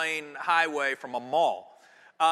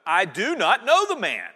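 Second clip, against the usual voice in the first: louder, forceful oath; cursing denial; 7 dB louder than usual. A narrator's reading. Louder heated oath. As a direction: Peter swears and curses at the bystanders, forceful denial. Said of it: "Then he began to curse".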